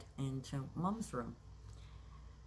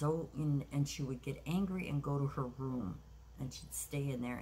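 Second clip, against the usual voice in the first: ordinary delivery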